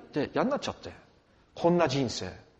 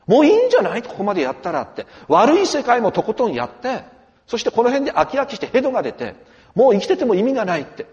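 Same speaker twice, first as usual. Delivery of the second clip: loud and much higher, pleading with a lost man; impassioned rebuke; 6 semitones higher, 9 dB louder than usual. Retold or imitated. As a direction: call out to him with full force, enough now. Preaching Jesus' rebuke, impassioned. (迷った若者に神が大声で迫るように)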